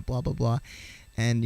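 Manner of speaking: usual speaking voice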